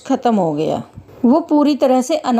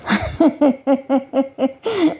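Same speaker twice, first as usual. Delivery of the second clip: mocking laugh; 3 semitones higher than usual. Guard laughs at the girl's offer of prasad, mocking. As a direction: laugh dismissively at a child.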